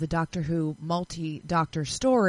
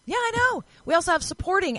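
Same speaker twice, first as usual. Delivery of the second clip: squealed high with delight; excited; 11 semitones higher, faster than usual. Real, not acted. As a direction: share the thrill, voice jumping up. Sharing exciting Emmy news.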